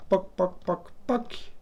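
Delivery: high-pitched "poc" sound effects; playful popping noises. Pretend. Imitated noise while colouring in squares.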